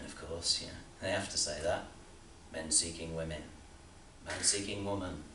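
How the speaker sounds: usual speaking voice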